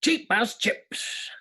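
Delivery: slow, drawn-out catchphrase; TV catchphrase impression. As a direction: stretch it out like a show's catchphrase. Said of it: "how to do the original".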